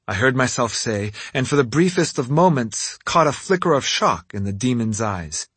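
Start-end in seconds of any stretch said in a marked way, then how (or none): none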